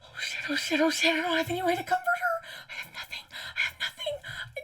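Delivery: ordinary delivery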